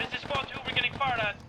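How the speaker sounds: urgent radio call. Real, not acted. Reporting incoming fire over radio.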